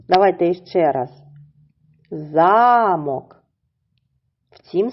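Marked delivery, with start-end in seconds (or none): 2.1-3.4 "Замок" with a long stressed За-, falling after; stress on first syllable. Shown as a demonstration. Teacher repeating the word once more, long stressed first syllable. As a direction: stretch ZA in "Замок": ZAAA-mok. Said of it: "припадає на перший склад".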